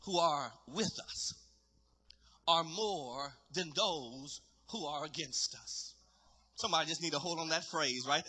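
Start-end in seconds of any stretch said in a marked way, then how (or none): 0.0-6.0 low, soft, measured reply; calm Elijah. Acted. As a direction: answer the panic quietly, with long pauses. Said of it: "He says".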